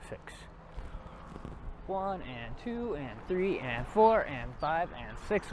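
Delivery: slow, sing-song count like a dance step. Shown as a demonstration. Instructor counts students through a slow drill like a dance. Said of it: "Are we dancing now? It sounds like it".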